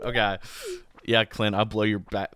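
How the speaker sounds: usual speaking voice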